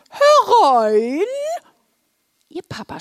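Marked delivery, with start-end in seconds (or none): 0.1-1.3 loud, high, drawn-out call. A narrator's reading. Wilma answers the knock at her door, loud stretched call. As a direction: call loudly to someone at the door. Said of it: "Wilma rief".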